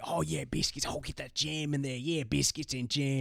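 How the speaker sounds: quietly hammed-up fake Australian accent; mock Australian-Cockney accent. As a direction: tease in a bad Aussie-Cockney voice, drawing out the jam. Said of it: "it's such an Australian accent".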